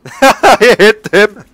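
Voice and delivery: loud, high laughing exclamation; real delighted laughter. Real, not acted. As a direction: crack up in glee while calling it out. Gleeful hit in the game.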